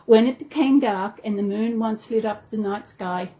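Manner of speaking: plain reading voice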